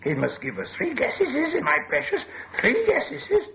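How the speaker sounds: high, hissy creature haggling; hissing Gollum. A narrator's reading. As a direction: hiss out the demand in a squeaky creature voice. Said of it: "Hissed Gollum".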